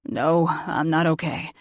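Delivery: slow, weak male voice; sick man voice. Acted. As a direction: groan it out slowly.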